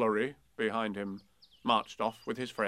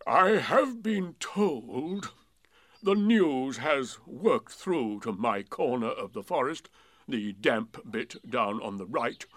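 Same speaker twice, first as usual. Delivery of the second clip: gloomy donkey voice; 6 dB louder, slower than usual. A narrator's reading. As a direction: speak as a weary old donkey. Eeyore voiced in story.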